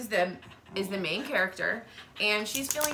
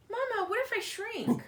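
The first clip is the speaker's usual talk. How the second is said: put-on much higher, anxious little girl; worried child; 12 semitones higher than usual. Wimberly asking her mama, high worried child voice. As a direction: ask mama fearfully like a small child.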